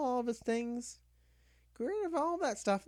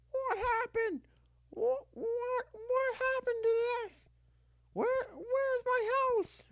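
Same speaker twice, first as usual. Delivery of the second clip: high, panicked yelling, drawn out slowly; furious yelling; 9 semitones higher, slower than usual. Retold or imitated. Brother yelling over his ruined Sims house, high and slow. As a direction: imitate him yelling in disbelief at the ruined game. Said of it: "screaming at the top of his lungs".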